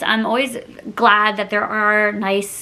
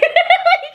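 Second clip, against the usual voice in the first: high burst of laughter; 4 dB louder than usual. Real, not acted. Vlogger cracking up at getting caught, giggling.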